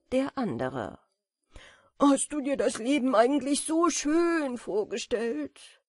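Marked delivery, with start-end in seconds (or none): 2.0-5.8 louder, high, breathless with joy; loud giddy delight. A narrator's reading. Moth asks his friend mid-leap, loud and giddy. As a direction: ask it while tumbling through the air. Moths rejoice while dancing.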